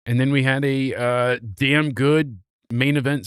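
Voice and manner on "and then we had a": ordinary talk, nothing marked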